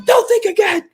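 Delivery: high mock voice. Dave's curt reply.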